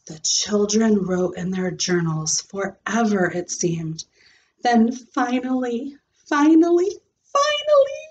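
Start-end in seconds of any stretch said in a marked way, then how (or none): 4.6-8.0 each finally climbs much higher; rising impatience, pitch climbing each time; building finally. A narrator's reading. Junie B waiting for show and tell, climbing higher.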